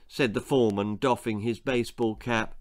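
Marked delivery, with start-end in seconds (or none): none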